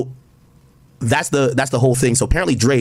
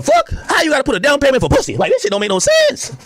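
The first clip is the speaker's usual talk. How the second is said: outraged disbelief; 13 semitones higher than usual. Real, not acted. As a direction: shriek it in disbelief. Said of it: "outraged disbelief".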